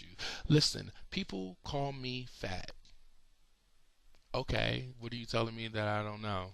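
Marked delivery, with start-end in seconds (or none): none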